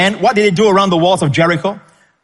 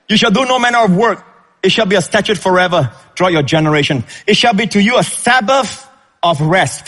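higher, stressing "no" and "Sabbath"; emphatic reading; 5 semitones higher than usual. Shown as a demonstration. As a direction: read the verse with weight on "no" and "Sabbath". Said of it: "Are you getting the emphasis?".

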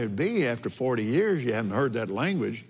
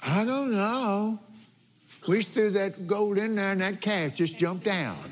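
higher, sheepish excuse voice with a laugh; Aaron making excuses; 7 semitones higher than usual. Retold or imitated. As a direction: play Aaron squirming out of the blame, pitched up. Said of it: "he said".

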